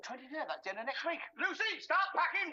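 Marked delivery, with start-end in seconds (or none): 1.4-2.5 high, loud, drawn-out announcement; jubilant call. Acted. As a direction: call out the order in triumph. Jubilant mock order called out.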